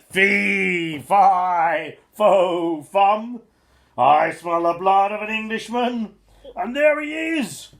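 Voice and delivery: loud, high roaring giant; giant shouting. A narrator's reading. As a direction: bellow it while chasing. Giant chasing Jack.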